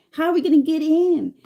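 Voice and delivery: high, loud panicked question; panicked retold question. Stuck outside, can't get in.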